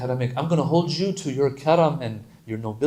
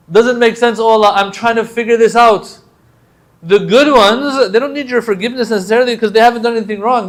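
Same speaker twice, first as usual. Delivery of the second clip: much higher and louder pleading; impassioned preaching; 8 semitones higher, 8 dB louder than usual. Acted. Preacher voicing the prayer to Allah, much higher and louder. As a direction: plead it out at full force.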